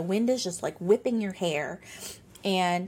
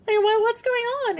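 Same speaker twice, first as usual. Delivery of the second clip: high, loud alarmed cry of what; jumping in, suddenly frightened; a startled, panicky question; 7 dB louder than usual. Retold or imitated. Alarmed asking medium what's wrong.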